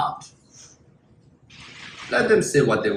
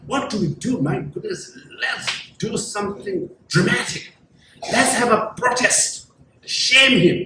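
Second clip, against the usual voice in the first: higher-pitched mock scheming; agitated plotters; 4 semitones higher than usual. Frustrated opponents plotting a protest against the leader, mock-dramatic agitation. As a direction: drag out the big words, high and dramatic.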